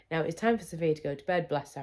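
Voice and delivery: usual speaking voice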